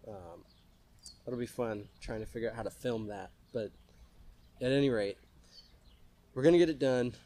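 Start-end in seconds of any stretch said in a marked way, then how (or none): none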